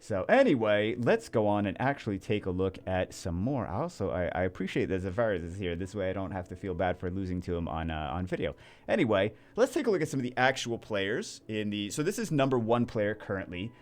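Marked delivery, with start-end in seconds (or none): none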